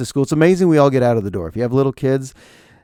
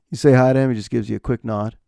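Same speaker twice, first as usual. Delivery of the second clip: usual speaking voice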